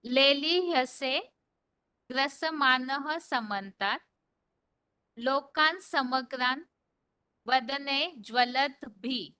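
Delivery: measured model reading of a shloka; pronunciation demo. Shown as a demonstration. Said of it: "एक बार उच्चारण सुन लीजिए".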